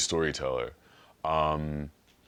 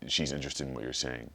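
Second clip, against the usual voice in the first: ordinary delivery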